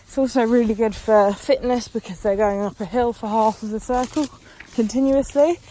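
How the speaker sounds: ordinary talk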